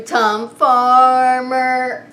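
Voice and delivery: normal talking voice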